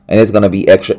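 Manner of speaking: ordinary talk, nothing marked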